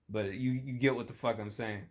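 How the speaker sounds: ordinary talk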